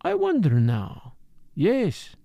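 put-on musing accented detective, pitch swinging up; murmured to himself. A narrator's reading. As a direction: puzzle it over aloud, lingering on the thought. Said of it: "he murmured to himself".

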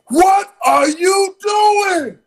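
high, slow, loud startled yell; imitating a startled shout. Attendant catching him in the club shower, high mock yell. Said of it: "He says to me".

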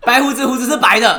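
hammed-up yelled spoiler, laughing; mock shout. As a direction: bellow it like the spoiler guy. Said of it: "这样大声的喊出来".

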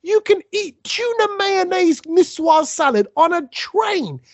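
high, slow, indignant mock outrage; upset outburst. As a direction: play the offended passenger, pitch up and drag it out. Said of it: "I was so upset 'cause I was like".

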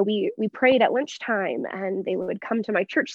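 ordinary talk, nothing marked

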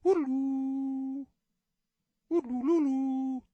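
high, drawn-out mock war chant; mock Tonto chant. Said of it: "I'm doing a Tonto voice".

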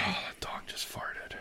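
hushed whispered aside. Real, not acted. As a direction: breathe it out unvoiced. Aside about the dog.

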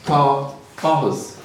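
"Tá áthas" said slowly in Gaelic; Gaelic phrase. Shown as a demonstration. Teaching the audience the Gaelic phrase, slow and careful. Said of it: "So if I want to say".

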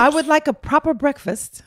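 a hint of a put-on British accent; fake British accent. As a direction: order breakfast as a mock Londoner. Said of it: "speak like y'all".

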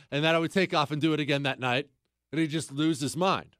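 ordinary talk

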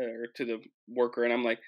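normal talking voice

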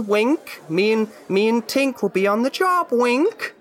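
rising sing-song winks; playful high voice. Acted. Conspiratorial wink joke.

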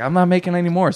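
high snippy clerk voice; imitating the clerk. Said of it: "she's like".

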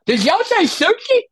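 high, loud lisping question; mock lisp. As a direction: burst in with an exaggerated lisp on "sushi". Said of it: "talking back to him with the lisp".

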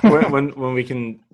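laughing while talking; fighting back giggles; trying not to laugh. Real, not acted. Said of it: "She's still giggling".

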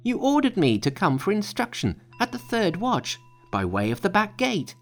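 high, earnest monkey voice; high-voiced Monkey. A narrator's reading. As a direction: play Monkey pitched well up. Monkey explains himself.

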